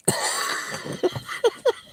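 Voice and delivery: high, wheezing laugh; real laughter. Real, not acted. Laughing at the club memory, high wheezy laughter. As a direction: laugh hard at your own story. Laughing at his own retold anecdote.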